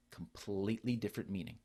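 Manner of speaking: ordinary delivery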